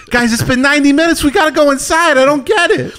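very high, exasperated impression; hammed-up urgent pleading to the guys; Mark Wahlberg. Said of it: "Do you think Mark Wahlberg's".

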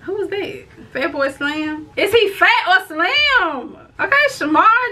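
normal talking voice

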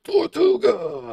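drawn-out rowdy pirate cry; pirate shout. Pretend. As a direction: bellow it like a drunk pirate.

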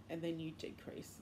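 ordinary talk

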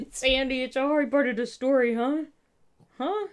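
cooing at the pet; puppy talk. Real, not acted. Talking to her dog.

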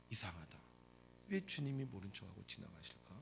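low, soft musing; 의아한 혼잣말. Acted. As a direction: think aloud, puzzled and hushed. Puzzled inner thought after being ignored. (낮고 작은 소리로 의아해하기)

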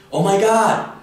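very high, loud shriek; shrieked oh my god. Shown as a demonstration. Teacher shows oh my god different ways, shrieked. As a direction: squeal it out in shock. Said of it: "the same thing we can say in English".

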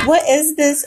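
ordinary talk

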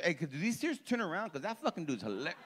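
quiet aside. Retold or imitated. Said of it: "looking at the manager".

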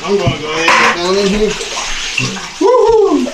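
loud, high excited yell; excited whoop. Real, not acted. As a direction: whoop as you plunge in. Getting into ice bath, whooping.

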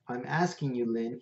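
ordinary delivery, nothing marked

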